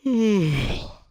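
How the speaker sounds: loud, high yawn falling in pitch; big yawn. Real, not acted. Player yawning mid-game, big loud yawn. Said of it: "Sorry, I yawned".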